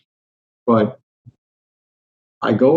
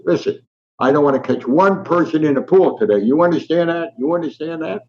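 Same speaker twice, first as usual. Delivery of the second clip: retold angry warning, pitched well up; angry coach; 6 semitones higher than usual. Coach warning players laughing over the railings, retold angry. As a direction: imitate a coach laying down the law, higher-pitched. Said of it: "Now he's really angry".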